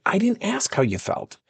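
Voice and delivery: retold high-pitched objection; cutting her off. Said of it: "I kept cutting her off".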